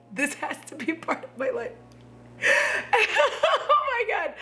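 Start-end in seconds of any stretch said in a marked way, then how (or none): 2.4-3.7 giddy squealing cackle; high squealing laugh. Real, not acted. Overjoyed at Care Bear nerd.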